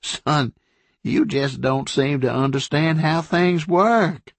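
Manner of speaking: condescending southern drawl; patronizing sheriff. A narrator's reading. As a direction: talk down to him like a kid. Sheriff talks down to governor.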